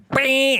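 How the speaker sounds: high, loud-ish drawn-out car noise; high vocal sound effect; imitated car noise. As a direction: mimic the car shooting off. Imitates his car setting off.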